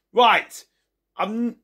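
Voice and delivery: plain reading voice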